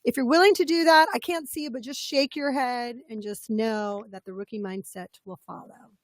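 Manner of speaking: ordinary talk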